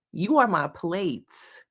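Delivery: slow, "You" stressed first. Shown as a demonstration. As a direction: land hard on "You", slowly. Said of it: "to emphasize".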